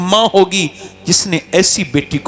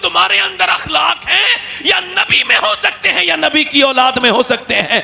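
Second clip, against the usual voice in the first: tearful confession; 8 semitones higher than usual. Acted. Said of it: "پھوٹ پھوٹ کے رویا".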